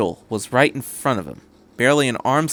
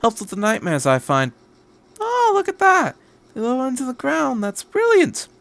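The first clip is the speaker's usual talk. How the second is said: put-on much higher, boyish delight; delighted Doctor; 12 semitones higher than usual. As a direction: gush over the sinking towers. Doctor marvels at the city.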